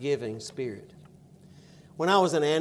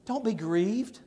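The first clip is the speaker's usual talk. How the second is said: gentle reassurance; 6 semitones higher, slower than usual. Acted. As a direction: comfort them gently, Don't be grieved rising. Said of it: "look at this attitude of this man".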